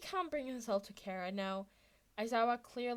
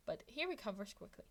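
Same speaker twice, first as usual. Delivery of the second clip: usual speaking voice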